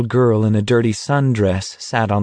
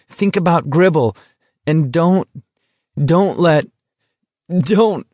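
higher, halting self-plea; struggling against hysterics; 6 semitones higher than usual. Acted. Garrity tells himself not to give in to laughter, struggling against hysterics. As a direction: plead with yourself, breaking off and restarting.

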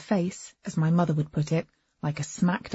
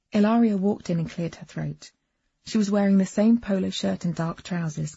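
ordinary delivery, nothing marked

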